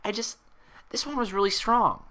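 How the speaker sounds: high, bubbly pony voice, puzzled; Pinkie voice. Acted. Pinkie asking Twilight about her hair, high and bubbly. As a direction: bounce up high and curious.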